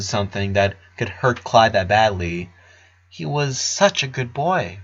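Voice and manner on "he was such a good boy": usual speaking voice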